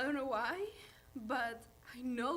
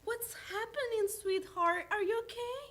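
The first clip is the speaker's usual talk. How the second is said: higher, urgent motherly concern; raised-pitch anxious questions; alarmed mother; 6 semitones higher, 3 dB louder than usual. Acted. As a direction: rush in worried for your daughter. Mom rushes in to crying daughter.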